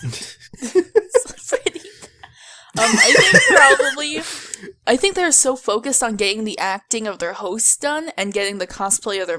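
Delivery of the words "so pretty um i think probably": talking through laughter; high, laughing voice; real laughter. Real, not acted. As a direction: try to carry on while cracking up. Cracking up after the imitation.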